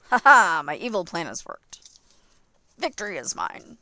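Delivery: gloating evil laugh, then triumphant boast; supervillain voice. Acted. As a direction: ham it up like a cartoon villain. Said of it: "a convincing supervillain voice".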